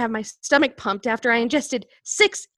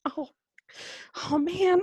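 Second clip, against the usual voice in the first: ordinary talk, nothing marked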